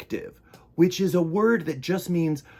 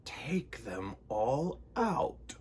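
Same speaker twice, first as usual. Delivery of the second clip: put-on slow whisper of an old sage; whispered wise man; 3 semitones lower, 8 dB quieter, much slower than usual. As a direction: breathe it out softly and slowly. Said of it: "said the wise old man".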